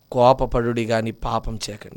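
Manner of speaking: hushed scripture reminder; soft verse. Acted. Verse repeated, dropped soft.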